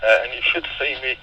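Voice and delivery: ordinary talk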